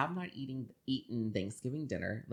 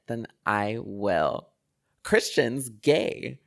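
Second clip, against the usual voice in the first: slow, louder, drawn-out reveal; dramatic announcement; 11 dB louder, much slower than usual. Retold or imitated. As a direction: drop the bombshell with relish. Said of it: "Like, very dramatic".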